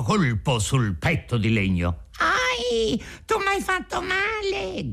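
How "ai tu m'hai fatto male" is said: extremely high squeaky complaint; crying out, tiny voice. A narrator's reading. Hurt piece of wood cries out to the carpenter, squeaky little wail. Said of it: "gridò raccomandandosi la solita vocina".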